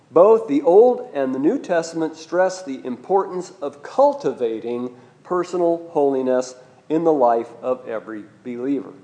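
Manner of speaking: normal talking voice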